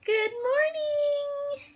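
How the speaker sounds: high, very slow singsong good morning; drawn-out sing-song. Real, not acted.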